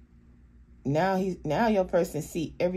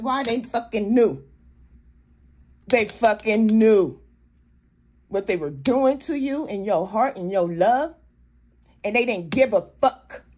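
loud, higher, hard emphasis on "fucking knew" and "fuck"; expressing sheer audacity; 5 semitones higher, 8 dB louder than usual. Real, not acted. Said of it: "it just expressed the audacity".

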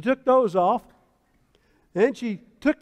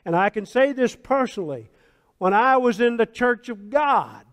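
ordinary delivery

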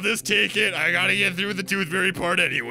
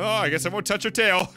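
ordinary talk

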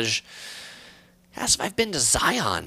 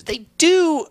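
they do jumps up high and louder; emphatic agreement; 7 dB louder than usual. Real, not acted. Agreeing with a viewer about big shoe sizes, high and eager.

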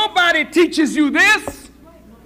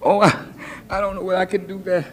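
put-on hesitant girl; 7 semitones lower, 5 dB quieter, much faster than usual. Acted girl answering her friend.

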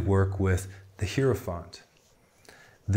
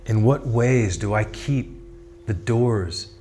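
measured, projected contemplative questions and affirmations; meditation prompts. Acted. Tarot guide voicing the listener's inner questions, solemn meditative delivery. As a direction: pose each thought deliberately, then leave long silence.

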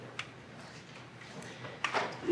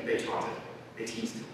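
ordinary delivery, nothing marked

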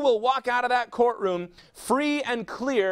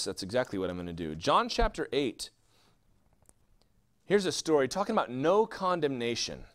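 normal talking voice

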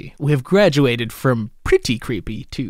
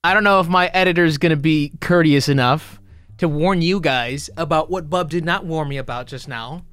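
usual speaking voice

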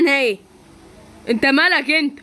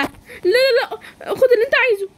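crying out in fear; 10 semitones higher than usual. Acted. Girl robbed by thugs, crying and pleading high. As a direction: beg them to take it and stop.

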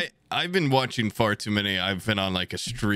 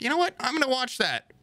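much higher put-on voice; imagined eager listener. Podcast listener takes movie tip, high imitated voice.

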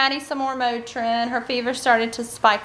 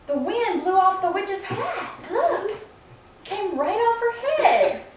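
storybook surprise; 7 semitones higher, 4 dB quieter, slower than usual. Acted.

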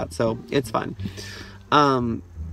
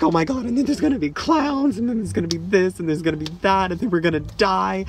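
mock panic; 6 semitones higher than usual. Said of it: "She's like".

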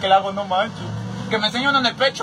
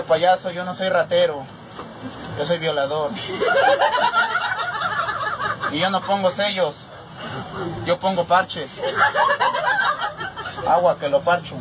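tough thug voice; 4 semitones lower than usual. Retold or imitated.

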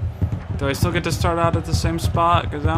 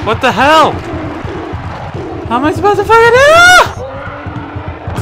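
furious shout, much higher and louder; real gamer rage; 13 semitones higher, 6 dB louder, slower than usual. Real, not acted. Raging at the game's controls with a high, loud furious shout. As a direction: blow up at the game, high and loud.

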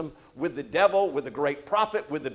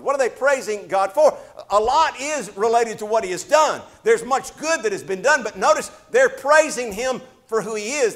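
louder, higher, pressing preaching; rising preacher fervor; 7 semitones higher, 9 dB louder than usual. Acted. Pastor urging the church about praise, fervent and loud. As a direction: lift the sermon with growing heat.

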